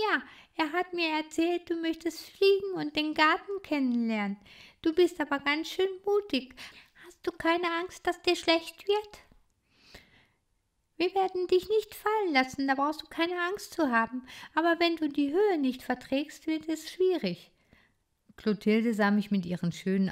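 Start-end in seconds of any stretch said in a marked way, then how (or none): 0.1-9.2 a shy little titmouse speaking; young bird girl. Acted. Clotilde voiced by reader. 11.0-17.5 put-on higher, eager child voice; a curious young critter speaking up; lively little hero. Bodo voiced by reader.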